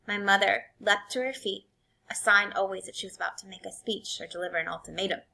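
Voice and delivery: ordinary delivery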